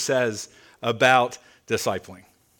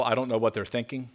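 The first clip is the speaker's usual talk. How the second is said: usual speaking voice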